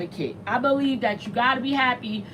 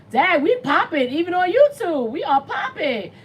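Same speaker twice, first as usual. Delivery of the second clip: excited; 5 semitones higher, 4 dB louder than usual. Real, not acted. Excited by viewer count.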